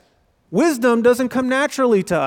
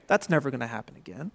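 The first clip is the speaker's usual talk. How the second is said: much softer, fast, deflated throwaway; resigned doubter; 8 semitones lower, 10 dB quieter, faster than usual. Pretend. Preacher voicing a doubting believer's prayer mindset, resigned and soft. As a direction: mutter it like someone who expects nothing.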